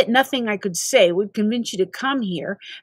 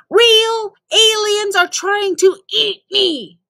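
exasperated outburst; 11 semitones higher, 5 dB louder, slower than usual. Acted. Exasperated complaint.

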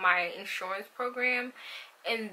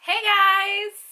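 excited greeting; 12 semitones higher, 8 dB louder than usual. Real, not acted. Vlog intro greeting.

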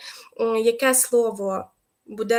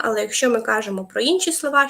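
ordinary talk, nothing marked

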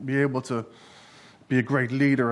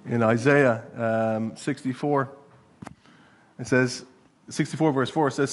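ordinary talk

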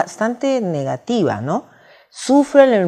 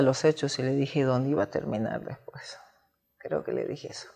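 real whisper, almost no voice; hushed whisper; 6 semitones lower, 11 dB quieter than usual. Real, not acted. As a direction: drop to a breathy confidential hush. Confiding aside after heated story. (susurro real, casi sin voz)

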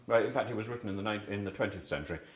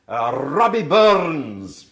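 rolled R in Robbie Burns, loud and slow; Scottish accent with a rolled R; 11 dB louder, much slower than usual. Shown as a demonstration. Teacher to class, Robbie Burns in a Scottish accent. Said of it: "I can actually do the r when I think of Scottish people".

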